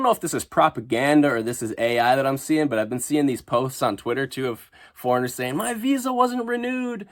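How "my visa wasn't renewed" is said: mocking whiny voice. Mocking foreigners' posts.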